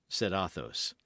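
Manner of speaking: ordinary delivery, nothing marked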